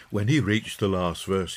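usual speaking voice

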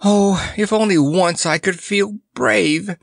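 high, wistful lion lament; mournful. A narrator's reading. Cowardly lion longs to feel brave, mournful. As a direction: wail it lightly, drawing out the longing. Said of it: "Shaking his mane mournfully".

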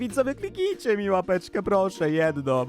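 high, drawn-out fake-sad whine; hammed-up slow, whiny pleading; mock sad. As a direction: beg pitifully in a squeaky pretend pout. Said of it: "jestem taki smutny".

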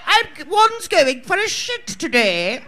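hammed-up posh upper-class English accent; mock posh English accent; mock posh British. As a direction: play a Downton Abbey aristocrat, lightly mocking. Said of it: "you all think all British people talk like".

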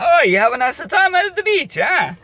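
imitating grandma asking the kids; sweet grandma. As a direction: coo it like a loving nonna. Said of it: "And she said".

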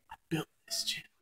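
dramatic whisper. Pretend. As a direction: whisper it grandly.

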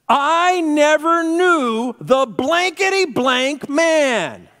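slow, high, full-volume yell; furious swearing denial. Acted. Peter swearing he never knew Jesus, furious drawn-out shout. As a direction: bellow each word with mounting outrage. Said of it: "He literally swears".

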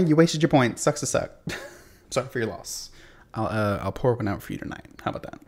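ordinary talk

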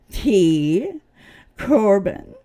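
T. Corbin hit loud and hard; T and Corbin spat out as separate loud beats; name punched. Real, not acted. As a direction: slam each part of T. Corbin down. Stressing a name with emphasis.

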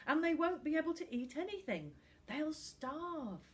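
put-on high, rushed, anxious child; a kid desperately explaining; urgent boy voice. A narrator's reading.

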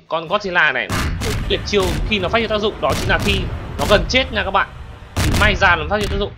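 ordinary talk, nothing marked

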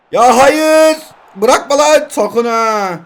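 high, loud cry of alarm; panicked shout. Real, not acted. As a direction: yell at the screen in panic. Rival about to score, panics. (panikle bağırış)

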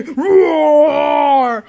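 shouted playful roar; monster roar. Pretend. Child scaring parents for the second time, playful monster roar. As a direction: roar to scare them again.

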